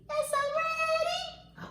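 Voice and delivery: very high, drawn-out little voice; slow, high put-on eager reply; pretend child's reply. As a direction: answer as the children in a squeaky-high voice. Answers in the children's voice.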